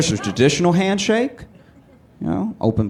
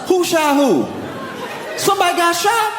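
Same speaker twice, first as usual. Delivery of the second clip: amused disbelief; 12 semitones higher than usual. Retold or imitated. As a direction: shout it out like it's juicy gossip. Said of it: "This man turns".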